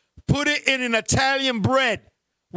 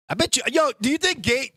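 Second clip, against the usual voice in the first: normal talking voice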